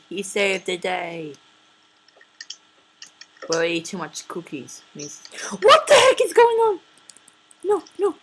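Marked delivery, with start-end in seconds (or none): 5.6-8.1 high panicked squeal; high surprised yelp. Real, not acted. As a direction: squeak out in alarm. Game glitches suddenly.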